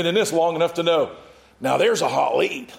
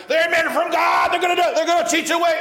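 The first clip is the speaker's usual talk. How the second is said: much higher, louder, strident calling out; shrieking possessed girl; 11 semitones higher, 3 dB louder than usual. Retold or imitated. Fortune teller girl shouting after Paul in the street, shrill high shout.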